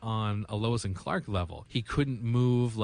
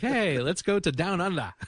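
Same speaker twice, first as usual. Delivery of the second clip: mock tourist pitch; 8 semitones higher, 3 dB louder than usual. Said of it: "I wouldn't be like".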